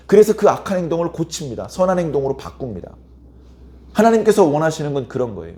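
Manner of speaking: ordinary delivery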